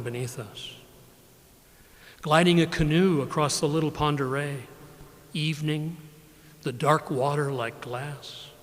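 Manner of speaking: measured, unhurried poem recital; poetry reading. Acted. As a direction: let each line land, then pause. Poet reads his poems.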